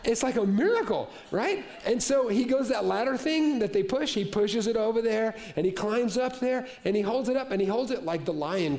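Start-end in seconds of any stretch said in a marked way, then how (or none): none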